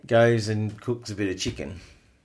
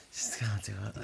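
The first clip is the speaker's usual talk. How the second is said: breathy whispered confession; 15 dB quieter, faster than usual. Real, not acted. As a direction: whisper it, almost to yourself. Hating mowing.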